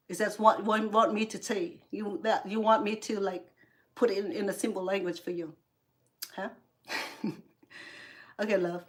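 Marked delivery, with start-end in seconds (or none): none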